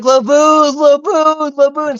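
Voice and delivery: half-hearted imitation of a wailing cry; mock crying scream. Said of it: "Brooks starts crying and screaming".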